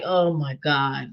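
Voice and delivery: low, soft weary groan; mock sigh. Imitating the girl lying in bed, a low soft groan. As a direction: lie there sighing oh my God. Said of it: "She was laying there like".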